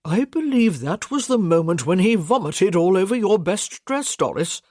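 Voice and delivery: put-on amused old knight teasing his wife; Sir Trevor voiced.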